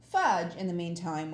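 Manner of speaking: ordinary delivery, nothing marked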